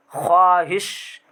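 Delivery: ख्वाहिश said slowly as खाहिश, no व; drawn-out खाहिश without the w; silent w. Shown as a demonstration. Teacher shows how the word is spoken. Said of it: "ख्वाहिश बोलते हैं लेकिन ख्वाहिश लिखा हुआ है".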